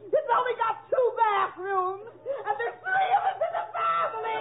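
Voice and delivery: high, hammed-up bawling complaint; put-on crying. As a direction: wail it, rising into fake sobs. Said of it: "Please don't cry anymore".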